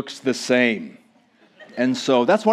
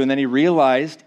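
ordinary delivery

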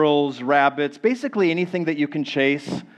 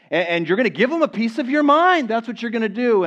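higher-pitched, worked-up resolve; mock bravado; 8 semitones higher, 3 dB louder than usual. Preacher playing someone rehearsing a hard conversation, mock bravado. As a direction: puff up with pretend boldness.

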